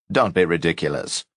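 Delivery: ordinary delivery, nothing marked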